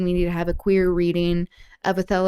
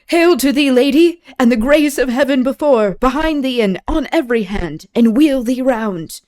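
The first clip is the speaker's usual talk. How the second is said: louder, higher Shakespeare verse read aloud; dramatic reading; 7 semitones higher, 8 dB louder than usual. Acted. Welcoming Desdemona ashore, read in a louder, higher dramatic voice. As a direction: declaim the greeting to the lady.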